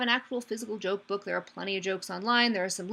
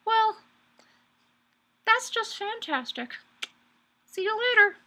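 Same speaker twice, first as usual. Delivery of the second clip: tiny mouth; 11 semitones higher than usual. Acted. Frog hiding his big mouth from the alligator, tiny-mouthed. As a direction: speak through tiny puckered lips. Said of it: "with a very tiny mouth".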